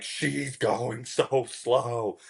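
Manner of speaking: very slow, stretched words; exasperated, drawn-out. Retold or imitated. Girl glaring at the speaker, drawn-out exasperation. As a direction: drag out every word in disbelief. Voices the glaring girl's silent message.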